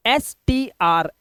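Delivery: एसटीआर spelled letter by letter, slowly; spelling out slowly. Shown as a demonstration. Teacher spelling out एसटीआर slowly for students. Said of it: "एसटीआर से बनता है स्ट्र".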